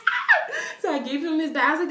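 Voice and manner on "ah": a very high, loud fangirl squeal; excited squeal. Retold or imitated. Fan squealing when the boy says her name. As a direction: squeal with delight. Her reaction to his greeting.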